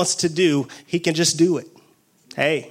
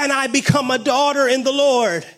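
raised, intense preaching voice; fervent preaching; 5 semitones higher than usual. Real, not acted. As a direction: preach it with fire to the congregation. Preaching at full force.

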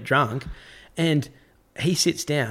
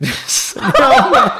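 laughing; 13 semitones higher, 10 dB louder, slower than usual. Real, not acted. Laughing at the memory.

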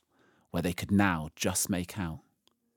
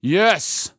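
loud, raised, commanding lion voice; great voice; 10 semitones higher, 10 dB louder than usual. A narrator's reading. Aslan answers behind their backs in a great voice. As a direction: proclaim it loudly. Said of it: "said a great voice".

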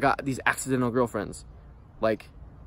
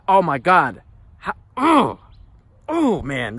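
sudden high cry of disbelief; overwhelmed outburst; 5 semitones higher, 7 dB louder, much slower than usual. Real, not acted. Ranting to viewers about school, he cries out. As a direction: voice jumps up, near tears with frustration.

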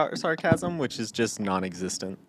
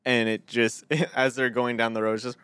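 usual speaking voice

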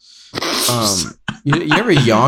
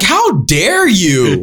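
slow, high, hammed-up indignation; mock offended; 8 semitones higher, 6 dB louder, much slower than usual. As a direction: play outraged diva. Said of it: "I was like".

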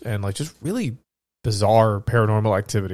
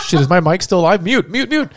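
raised, laughing fluster; mock panic; 7 semitones higher, 5 dB louder than usual. Host pretending the mic was left on, mock panic. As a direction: play caught on a hot mic, laughing.

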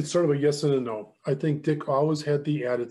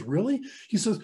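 higher, wide-eyed questioning; retold surprise; 6 semitones higher than usual. New group member asks his mentor, surprised. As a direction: imitate an astonished newcomer.

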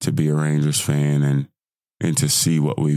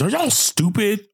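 excited. Real, not acted. Hyped after World Series win.

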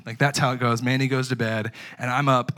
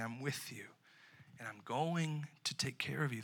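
tender promise; 14 dB quieter than usual. Pretend. Jesus promising the congregation care, in a soft voice. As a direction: promise it quietly, like a comfort. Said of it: "Jesus is saying".

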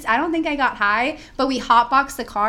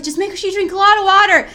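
much higher and louder, a hammed-up drunk buddy; drunk friend; 10 semitones higher, 8 dB louder than usual. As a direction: play the sloppy drunk who promises to look after her. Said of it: "And he was like".

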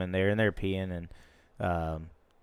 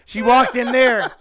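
laughing insistence; 13 semitones higher, 8 dB louder, slower than usual. Real, not acted. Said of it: "Did you say she?".

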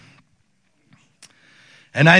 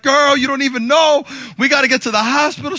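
urgent retort; 10 semitones higher, 4 dB louder than usual. Retold or imitated. Husband hurrying his wife to hospital.

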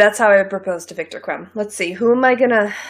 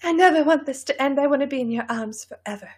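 high, hammed-up romantic plea, fading softer; dramatic proposal; 4 semitones higher, 4 dB quieter, faster than usual. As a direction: gush like a melodramatic lover. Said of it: "so dramatic".

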